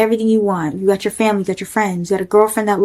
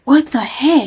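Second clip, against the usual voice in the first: higher-pitched mock shock; retold incredulous reaction, pitched up; shocked disbelief; 5 semitones higher, slower than usual. Girlfriend seeing him order rum and coke, shocked.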